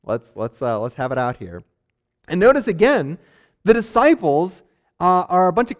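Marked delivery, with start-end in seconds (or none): 0.0-1.6 calm parent. Retold or imitated. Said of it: "it's like a parent's like".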